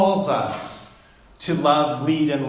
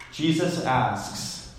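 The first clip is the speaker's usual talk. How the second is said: ordinary talk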